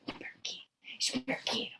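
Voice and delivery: whispered urging. Real, not acted. Owner whispers to the dog, urging it to bark.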